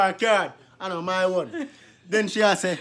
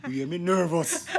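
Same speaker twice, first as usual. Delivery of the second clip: ordinary talk, nothing marked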